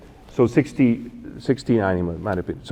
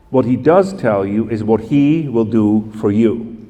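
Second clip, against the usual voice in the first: ordinary delivery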